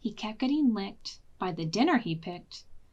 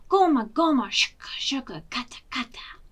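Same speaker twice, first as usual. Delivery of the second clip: whisper; 3 semitones higher, 3 dB louder than usual. Acted. Sound effect of ninjas.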